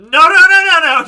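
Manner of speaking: high, loud string of no's; loud alarmed no, no, no; loud protest. Acted. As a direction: shout her down in alarm. Refusing Iris joining.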